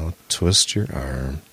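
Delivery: slow, low mock menace; a slow, low, joking threat. Playful threat to friend.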